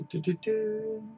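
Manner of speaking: usual speaking voice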